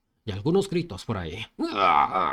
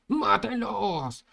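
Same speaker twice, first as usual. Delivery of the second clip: high, slow drawn-out yell; stretched high battle shout; battle cry; 10 semitones higher, much slower than usual. Acted.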